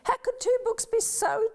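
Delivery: put-on child amazed the two Bibles match.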